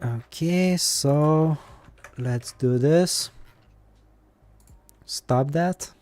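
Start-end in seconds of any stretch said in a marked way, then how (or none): none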